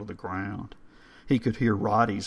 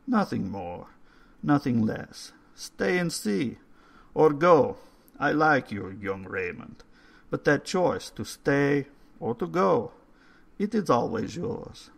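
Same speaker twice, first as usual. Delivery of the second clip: put-on old caretaker voice; 5 semitones higher than usual. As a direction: read the stone like a courteous old man. Old man offers a choice.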